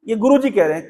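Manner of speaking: ordinary talk, nothing marked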